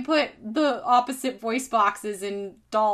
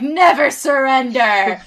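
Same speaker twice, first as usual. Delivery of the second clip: loud, drawn-out heroic vow; mock battle cry; G I Joe; 3 semitones higher, 9 dB louder than usual. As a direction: bellow it like a toy soldier. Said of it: "the G I Joes would say".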